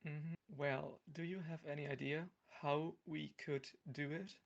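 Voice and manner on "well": usual speaking voice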